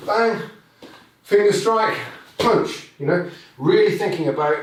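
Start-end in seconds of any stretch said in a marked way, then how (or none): 0.1-2.9 punchy shouted strike words; sharp strike calls. Shown as a demonstration. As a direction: call out each strike. Demonstrating strikes with kiai.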